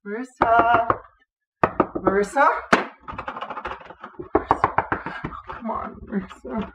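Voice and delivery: urgent calls through a door; panicked frantic pleading. Acted. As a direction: call out, growing desperate.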